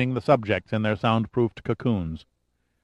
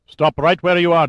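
raised, higher command; commanding halt; 6 semitones higher, 6 dB louder than usual. A narrator's reading. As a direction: call out a sharp order to halt. Armed agent halts the doctor.

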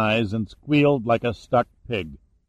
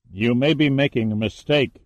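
usual speaking voice

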